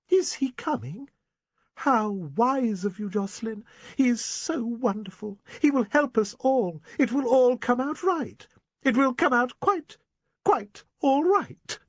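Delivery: an overwrought spinster near hysteria; gushing Miss Prentice. Acted. Miss Prentice hears Copeland is coming.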